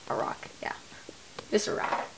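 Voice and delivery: whispered aside. Real, not acted. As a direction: breathe it out under your breath.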